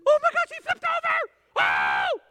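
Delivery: very high, loud squealing excitement; thrilled parent. Retold or imitated. Proud parent seeing the baby roll over, squealing.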